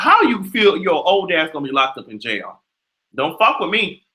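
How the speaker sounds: usual speaking voice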